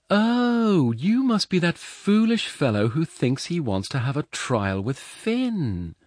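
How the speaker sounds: high, loud woman's voice with a big drawn "Oh"; needling foster mother. Acted. Foster mother needling the giant about Finn, high and loud.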